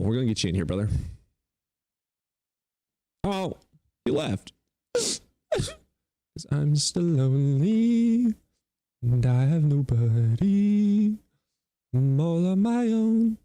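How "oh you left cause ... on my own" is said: high, loud, slow hammed-up lament; mock lonely whine. Streamer alone after guest left, mock whining. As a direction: wail it like a sad puppy.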